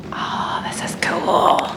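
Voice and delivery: slow breathy whisper of wonder; awed whisper. Real, not acted. Inside the old church building, slow awed whisper. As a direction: hush it like you're in a church.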